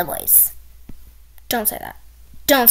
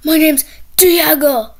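imitating Diego; 9 dB louder, slower than usual. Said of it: "He told me to say his name like that".

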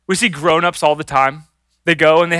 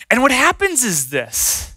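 ordinary delivery

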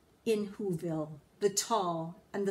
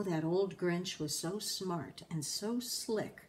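usual speaking voice

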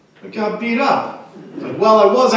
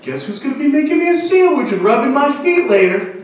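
higher, louder bully-sister taunt; mock tough sister; 7 semitones higher, 4 dB louder than usual. Comic imitating big sister challenging him to wrestle, mock tough. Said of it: "walk around the house like".

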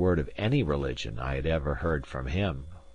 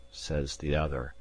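ordinary delivery

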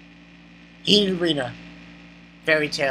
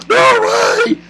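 high, drawn-out mock cry; over-the-top imitated outburst; mimicked disbelief; 14 semitones higher, 4 dB louder than usual. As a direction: wail it in exaggerated disbelief. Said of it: "and Jin's like".

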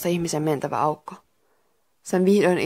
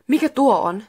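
higher-pitched, mildly scared question; startled, raised character voice; frightened; 6 semitones higher, 4 dB louder than usual. A narrator's reading. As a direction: ask with a touch of alarm. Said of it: "Tuula kysyi pelästyneenä".

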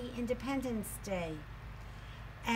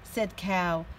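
lively, louder storybook voice; teacher reading a picture book aloud to her class; reading to children; 9 dB louder than usual. A narrator's reading. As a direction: read it out with energy for little kids.

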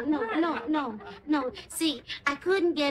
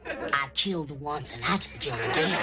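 joking villain growl, much lower; mock menace; 12 semitones lower than usual. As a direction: play the hammy killer, half-laughing. Mock threat to roommate Addie.

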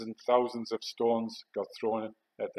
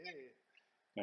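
high, soft, drawn-out mock cheer; imitated crowd cheer; 5 semitones higher, 4 dB quieter, much slower than usual. Young lads rejoicing at the soldier's shout, imitated cheer. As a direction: mimic a distant cheer, trailing away. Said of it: "all the young lads were going".

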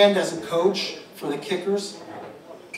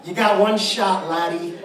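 Irish brogue; 3 semitones higher, 7 dB louder than usual. Retold or imitated. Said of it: "He had a bit of an Irish brogue".

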